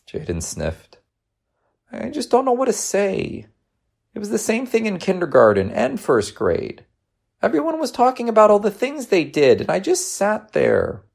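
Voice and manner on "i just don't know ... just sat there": put-on high-pitched, sorry-for-himself child; little boy voice, pitched well up; upset young boy. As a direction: confess like a dejected schoolboy.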